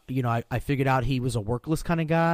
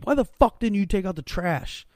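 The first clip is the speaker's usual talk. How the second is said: higher, yelling at the new guy; mock angry yell; 6 semitones higher than usual. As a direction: snap at him like a bully boss. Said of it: "be like".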